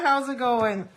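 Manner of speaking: ordinary talk, nothing marked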